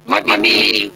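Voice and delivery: buzzing, distorted mic voice; like speaking through a whirring fan. Real, not acted. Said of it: "sounds like you're talking into a fan".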